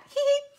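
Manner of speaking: short high, loud giggle; delighted squeal. Real, not acted. Crafter admiring her stamped bow, delighted squeal. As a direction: let out a squeaky "hee" of delight.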